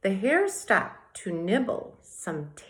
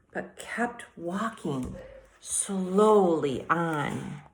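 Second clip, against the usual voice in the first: slow plod; slower than usual. A narrator's reading. Narrating the tortoise's slow plod.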